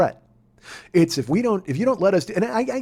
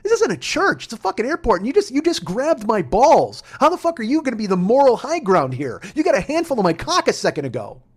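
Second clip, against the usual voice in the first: incredulous outrage; 8 semitones higher, 4 dB louder than usual. Retold or imitated. Arguing with the agent about moral high ground, high and outraged. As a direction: argue back, disbelieving. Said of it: "I'm like".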